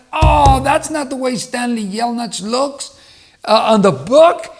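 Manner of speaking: high, loud, slowed outcry of disbelief; surprised disappointment. Retold or imitated. Kids seeing the Holes movie, loud high surprised protest. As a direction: stretch it out like a let-down fan.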